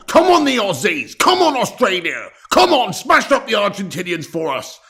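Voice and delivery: loud, high fan shout; each "come on" punched high, then falling away; rallying cheer. Real, not acted. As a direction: urge the team on at full voice. Cheering Australia on before the game.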